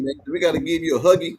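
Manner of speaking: high mock baby voice, playful; teasing baby talk. Host teases the teary guest in a mock baby voice. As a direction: coo it at him like he's a little baby.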